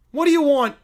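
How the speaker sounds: high, loud demand; irritated child. Acted. Sibling taking the phone, irritated. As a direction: answer the phone crossly.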